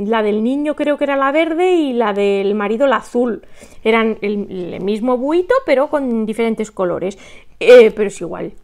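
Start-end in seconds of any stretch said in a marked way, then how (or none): none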